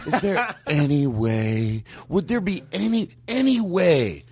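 very slow, meek, with laughter; Brian Regan voice. Retold or imitated. A comic mocks his own plea to the worker, meek and slow. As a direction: stretch out a timid plea, giggling. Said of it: "and I did it with that voice".

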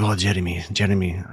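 lightly put-on Lebanese accent. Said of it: "I'm using a Lebanese accent".

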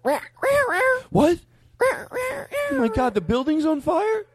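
very high, slow cat voice; cat crank call voice. Retold or imitated. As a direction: squeal it like a talking cat. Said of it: "do what he does".

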